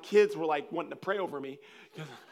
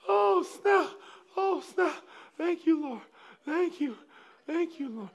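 high voice fading from loud to normal volume; mock exhausted relief; 14 semitones higher, 5 dB louder than usual. Man thanking the Lord after laughing, high spent voice. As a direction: come down, spent, from a mock fit. Winding down from acted laughing fit.

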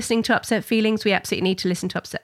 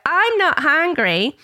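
put-on high, slow sing-out of a child's refusal; imitated picky child; 8 semitones higher, 3 dB louder, slower than usual. Daughter after a few mouthfuls, imitated high and drawn out. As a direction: stretch it out like a child refusing food.

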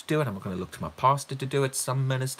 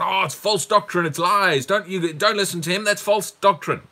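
loud, higher accusing cry; mock outcry; 7 semitones higher, 6 dB louder than usual. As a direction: cry it out as an alarmed objector. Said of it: "gonna cry out".

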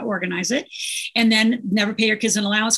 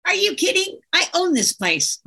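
imitating a cocky little boy, pitched higher; mock-smug boast, slowing down on the claim; 8 semitones higher than usual. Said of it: "he said it kind of, you know, smug".

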